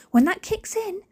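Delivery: high and loud, mock alarm; high, loud dramatic. Real, not acted. Warning her family about the medication kicking in, high and loud. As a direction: pitch it up like a warning siren.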